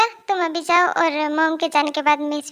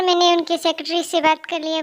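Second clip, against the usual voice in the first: ordinary delivery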